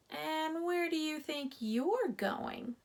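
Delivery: slow, grouchy gopher voice; disagreeable voice. A narrator's reading. Gopher confronts the mouse in his run, slow grouchy voice. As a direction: stop the intruder slowly. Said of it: "a disagreeable voice ahead of him said".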